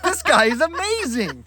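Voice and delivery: loud, high, drawn-out marvelling; hammed-up delighted exclamation; retold amazement. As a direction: gush slowly at full volume as you retell it. Retold delight at hotel host.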